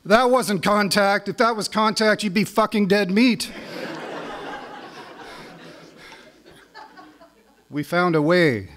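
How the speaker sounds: spoken-word poetry reading; poem reading. Acted. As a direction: read it like a performed poem, building with the story. Reads his hockey poem.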